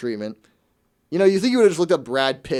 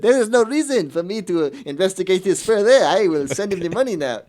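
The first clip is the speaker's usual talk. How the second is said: higher, louder, hammed-up French accent; mock French accent; 6 semitones higher, 4 dB louder than usual. French woman decides to send the money, mock French accent. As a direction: play the duped woman with an over-the-top French accent. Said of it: "I kept doing the French accent".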